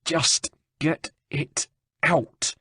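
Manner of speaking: slow, high and strained through clenched teeth; through gritted teeth. A narrator's reading. Rosa begs Antonio through gritted teeth, slow and strained. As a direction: force each word out through the pain. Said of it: "Rosa gritted her teeth".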